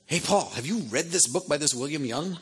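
high, eager caller voice; excited friend voice. Retold or imitated. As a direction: play a thrilled friend. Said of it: "they'd go".

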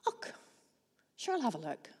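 a hint of a calm dad, soft and easy; lightly voiced unbothered father. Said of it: "Dad very calmly went".

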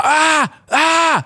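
high-pitched imitated kid screams; mock screaming, two high cries; child's scream. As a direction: mimic short high screams of a sick child. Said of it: "han bara skriker".

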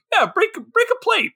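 high-pitched mock-manic mother; unhinged mom. Imitating unhinged mom egging on kid.

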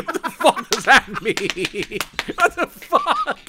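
high words dissolving into laughter; helpless laughing disbelief. Real, not acted. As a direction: gasp the question out through giggles. Cracking up at non sequitur.

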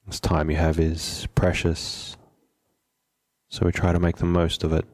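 unhurried meditation guide, long pauses between phrases; measured, settled meditation instruction; guided meditation. Acted. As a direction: calmly guide them to settle, leaving space after each phrase.

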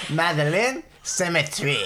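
a silly mock French accent; bad French accent. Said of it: "really bad French accent version".

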